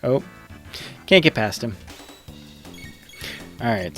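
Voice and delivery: normal talking voice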